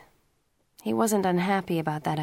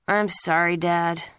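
ordinary delivery

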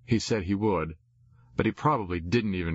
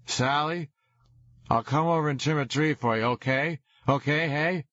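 put-on drunken shouting; 7 semitones higher than usual. A narrator's reading. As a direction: holler it sloppily.

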